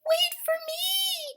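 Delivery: high, slow little mouse call; mouse crying out. Acted. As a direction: call after them as a tiny mouse. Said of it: "cried Mouse".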